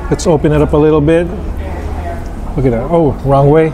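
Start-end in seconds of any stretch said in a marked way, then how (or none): none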